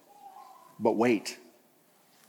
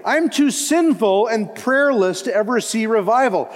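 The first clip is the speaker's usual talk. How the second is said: mock the excuse in a pleading, higher voice; a complaining believer voiced higher and louder; imagined self-doubting believer; 8 semitones higher, 4 dB louder than usual. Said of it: "you might say".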